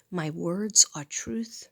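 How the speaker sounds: slow, firm "My words are truth"; steady spoken mantra; affirmation spoken. Real, not acted. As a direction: declare it out loud, slowly. Repeats affirmation differently.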